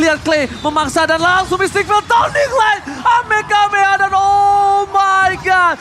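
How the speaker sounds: loud, high, stretched victory shout; fighting game announcer. Acted. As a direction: scream the comeback to the arena. Game-winning hype call.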